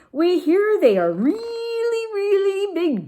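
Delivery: REALLY, REALLY stretched long, high and loud; drawn-out emphasis. A narrator's reading. Dog telling kitten about dinosaurs, "really" stretched high. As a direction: hold each "really" out on a high note.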